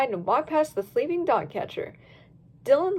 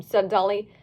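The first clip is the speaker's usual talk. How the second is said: usual speaking voice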